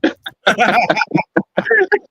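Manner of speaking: loud, high burst of laughter; real high-pitched belly laugh; hearty laugh. Real, not acted. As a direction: crack up loudly at the teasing. Cracking up at the teasing.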